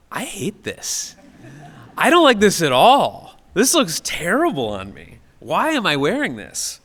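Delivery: sudden revulsion at your own outfit; disgusted. Retold or imitated. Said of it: "I just had this sort of experience of being like".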